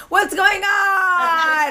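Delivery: high, very drawn-out party call; sing-out mock greeting; flamboyant drunk man. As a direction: imitate a drunk hollering through a door. Acting drunk man outside the door.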